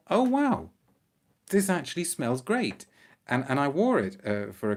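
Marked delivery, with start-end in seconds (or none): 0.1-2.9 high, wowed retelling of a first impression; pleasant surprise. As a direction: let the wow leap up high, delighted by the discovery. Re-enacts first smell of bottle.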